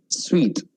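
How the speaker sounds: "Suite" said as sweet; pronounced suite. Shown as a demonstration. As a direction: say "Suite" like sweet, for a hotel room. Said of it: "What you wear is suit".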